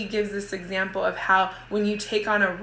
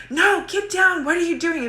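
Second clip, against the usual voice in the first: much higher, louder panicked shout; panicked yell; 10 semitones higher, 4 dB louder than usual. Retold or imitated. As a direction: yell at the child to get down, alarmed. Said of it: "and I go crazy".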